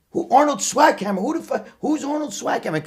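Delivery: retold dad, mockingly baffled; mock incredulous father. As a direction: imitate dad sneering at the name. Father scoffing at Arnold posters.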